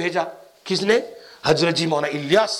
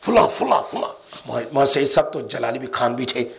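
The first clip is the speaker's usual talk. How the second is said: ordinary talk, nothing marked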